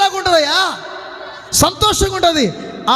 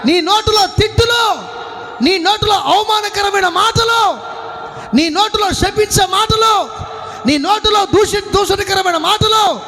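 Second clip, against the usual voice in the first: high, insistent listing; preacher's rebuke; 4 semitones higher than usual. Real, not acted. Preacher listing sins of the mouth to congregation, rebuking. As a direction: raise the pitch and hammer out each item.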